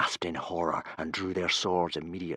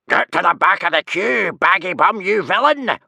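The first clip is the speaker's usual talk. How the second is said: high, very loud telling-off; angry roar; 11 semitones higher, 10 dB louder than usual. A narrator's reading. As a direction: roar at a queue-jumper. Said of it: "roared Tough Nut Senior".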